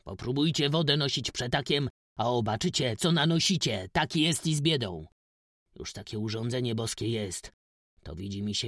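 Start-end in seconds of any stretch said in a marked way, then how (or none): 0.0-5.1 loud, higher, emphatic argument; raised-voice peasant retort; heated rebuttal. A narrator's reading. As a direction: argue it back forcefully. Farmer rebuffs plea for the poor.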